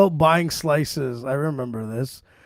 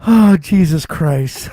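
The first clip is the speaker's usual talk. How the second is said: exasperated groan; 6 dB louder, much slower than usual. Retold or imitated. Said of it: "I'm like".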